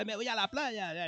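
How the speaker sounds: high, soft mock holidaymaker voice; excited holidaymaker. Co-host acts out someone off to the beach, mock excited holidaymaker.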